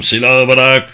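loud, firm police command; shouted order. A narrator's reading. Policeman to armed man, weapon down, shouted. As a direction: raise your voice as a cop ordering. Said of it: "Başka bir polisin bağırdığını duydum".

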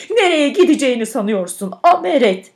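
loud, high furious yell; angry shout. A narrator's reading. Woman sliding downstairs yelling at the milk jug, angry shout. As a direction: yell it, rising on the last word. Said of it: "öfkeyle bağırıyordu".

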